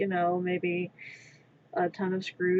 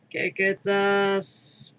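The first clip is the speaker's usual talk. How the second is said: quoted cartoon voice; 2 semitones lower, much slower than usual. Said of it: "quoting D B Z abridged".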